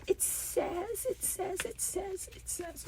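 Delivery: slow start, dwindling to a tiny murmur; fading repetition. Real, not acted. Stalls while about to read.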